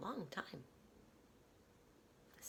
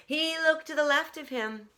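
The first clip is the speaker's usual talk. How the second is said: plain reading voice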